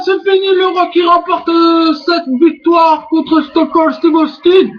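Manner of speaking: high, shouted play-by-play; wrestling commentator. Acted. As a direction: call the pin like a hyped announcer. Play-by-play of the finishing move.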